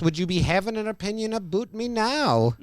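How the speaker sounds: lightly hammed Irish lilt; bad Irish accent. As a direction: imitate a poor Irish accent.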